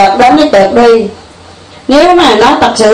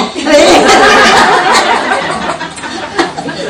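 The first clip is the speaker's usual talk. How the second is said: real laugh at her own story; 5 semitones higher, 3 dB quieter than usual. Real, not acted. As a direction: bursts out laughing. Laughs at the retold quarrel.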